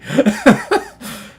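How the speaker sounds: high loud burst of laughter. Real, not acted. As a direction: laugh out at his own joke. Laughs at car payment joke.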